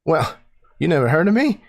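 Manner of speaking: brash pilot introducing herself to the party; Southern captain. Acted. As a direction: brag your name like a swaggering pilot. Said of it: "she says".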